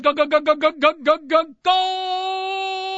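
rising go go go call, pitched high; Spanish soccer announcer. Retold or imitated. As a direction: chant it like a Telemundo goal call. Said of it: "Telemundo to hear that guy".

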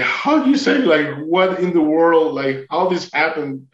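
high, drawn-out bewilderment; retold disbelief. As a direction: start high and incredulous, then slow down. Disbelief at the rescue by dolphin.

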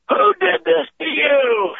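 slow, high mock wail of maternal fury; mother bear demanding answers; outraged mother. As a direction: imitate a horrified mom, high and drawn out. Mother sees child's broken tooth.

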